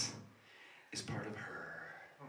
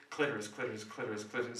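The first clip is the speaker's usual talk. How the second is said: muttered search loop; 4 semitones lower, 10 dB quieter, faster than usual. Acted. Mental search looping.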